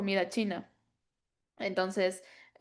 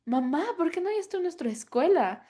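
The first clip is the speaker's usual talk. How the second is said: put-on child's complaint; 8 semitones higher than usual. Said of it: "Y lo han así como".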